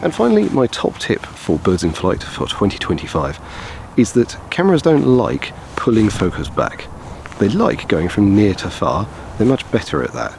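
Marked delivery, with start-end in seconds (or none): none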